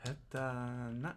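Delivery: Heta na said slowly with a rising na; careful teacher model; repeat-after-me pace; slow model reading. Shown as a demonstration. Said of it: "repeat-after-me drill".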